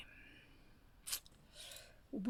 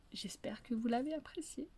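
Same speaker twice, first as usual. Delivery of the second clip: unvoiced closing whisper; storyteller whispering to listeners; soft whisper; 10 dB quieter than usual. Real, not acted. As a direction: whisper a gentle goodbye.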